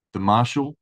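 ordinary delivery, nothing marked

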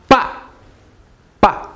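"ba" short, starting high and dropping; high, abrupt falling "ba"; sharp fourth tone. Shown as a demonstration. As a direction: throw out each "ba" with a sharp fall. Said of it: "Fourth tone".

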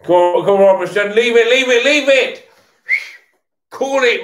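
high, loud urgent yells at players; shouting at the telly. Real, not acted.

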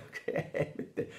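giggling through the words; a boy who can't stop laughing at his prank. Acted. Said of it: "non la smetteva più di ridere". (detto ridendo a crepapelle)

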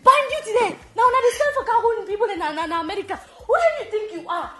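loud, high-pitched imitation of warfare praying; mock fervent prayer. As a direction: pray at full force, hammed up for laughs. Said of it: "they pray for her".

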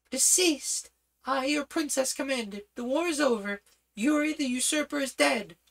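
high, imperious royal command; commanding princess. Acted. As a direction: command them as their princess. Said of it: "regal presence".

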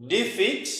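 Defeat said with a sharp rise at the end; rising intonation. Shown as a demonstration. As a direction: lift the pitch high on Defeat. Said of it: "with a rising intonation".

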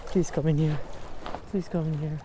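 high, worried pleading; anxious pleading. Real, not acted. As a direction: fret about losing the fish. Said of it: "scared I'm gonna lose it".

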